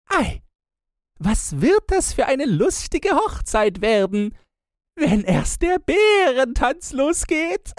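very high, loud gleeful teasing; squealing mockery. A narrator's reading. Sister gleefully mocking the coming bear wedding. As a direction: squeal with mock delight. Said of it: "spötteln und witzeln".

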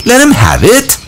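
put-on high, pushy shout for the little boy; a boy shouting across the assembly. A narrator's reading. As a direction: yell it over the crowd's laughter. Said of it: "shouted Piggy".

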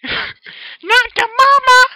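very high, very loud, slow screech; screechy baby shout. Acted. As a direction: shriek it like the cartoon baby. Dragon shouts meme line.

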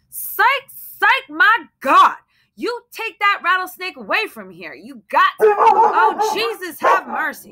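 high, loud panicked pleading; terrified shriek. Acted. Terrified wife begs husband to remove the snake, shrieking high. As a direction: shriek it at him, begging him to take it away.

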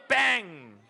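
drawn-out 뺑 like English bang; American gunshot noise. Shown as a demonstration. Pastor shows how America imitates gunfire, long bang. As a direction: stretch an American-style bang, slowly. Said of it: "이래요".

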